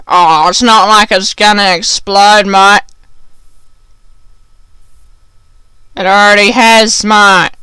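lightly voiced mouthy kid; a bit of swagger, imitating a schoolmate; dismissive schoolkid. Kids answering the bus driver back, mock dismissive. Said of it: "a few of the kids were like".

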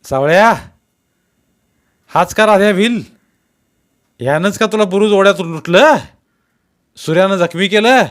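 loud, high officer's interrogation; shouted questioning. A narrator's reading. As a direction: yell each question at the witness. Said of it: "जमादार ओरडला".

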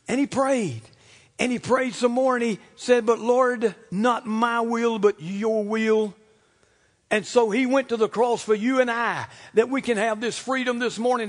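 loud, high, stretched pulpit cries; forceful preaching. Real, not acted. Preacher telling congregation of Jesus' prayer, forceful preaching.

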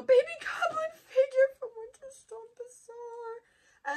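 high, choked-up gush. Real, not acted. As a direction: melt a little on the favorite item. Listing items, gushing at goblin.

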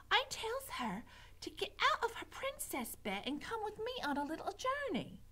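put-on high, simple-minded serving-girl voice; serving girl Mig. A narrator's reading. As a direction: recite it eagerly, up high. Mig continues the plan.

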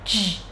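"J" buzzed in the throat; voiced j. Shown as a demonstration. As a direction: vibrate the vocal cords on a lone "J". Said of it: "rung dây thanh quản". (âm "J" hữu thanh, rung dây thanh quản)

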